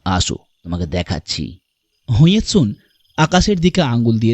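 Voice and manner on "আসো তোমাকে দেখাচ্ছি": deep, gentle invitation; mysterious stranger. Acted. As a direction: beckon a child in a low voice. Stranger invites boy to look. (নিচু গলায় ডেকে নেওয়া)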